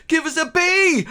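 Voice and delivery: put-on high, loud old woman's cheer. Old woman in the crowd starts a chant, high shout. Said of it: "an old woman says".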